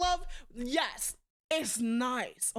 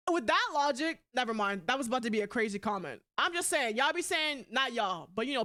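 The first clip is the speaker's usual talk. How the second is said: ordinary delivery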